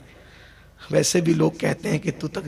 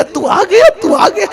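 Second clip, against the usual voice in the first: crying with joy; 8 dB louder than usual. Acted. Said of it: "روتے".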